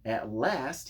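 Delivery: usual speaking voice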